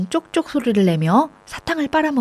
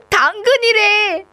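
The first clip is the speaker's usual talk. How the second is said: high, slow, laughing exclamation; 11 semitones higher, 3 dB louder, much slower than usual. A narrator's reading. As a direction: giggle through the words. Kids laughing at mistake. (웃으며 외치는 말)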